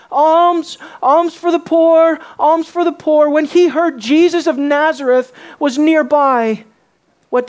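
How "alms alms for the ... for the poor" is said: drawn-out, louder beggar's call; raised, slow begging refrain. Acted. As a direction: call repeatedly for alms, higher and louder. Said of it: "vaguely calling out".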